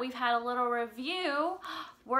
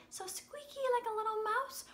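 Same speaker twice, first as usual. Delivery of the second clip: much higher, tiny squeaky mouse voice; 11 semitones higher, 3 dB quieter than usual. Shown as a demonstration. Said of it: "pitch was when something is high".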